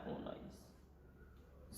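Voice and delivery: slow breathy whisper, almost soundless; whispered to himself. Real, not acted. Teacher writing the answer on the board, whispering it slowly. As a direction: murmur the number as you write it down.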